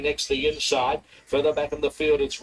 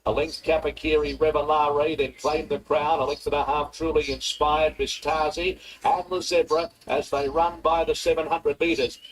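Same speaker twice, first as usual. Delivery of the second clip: normal talking voice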